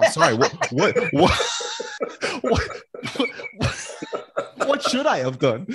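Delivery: laughing, stammering what what; helpless giggling excuse; laughing through a flustered defence; laughing plea. Retold or imitated.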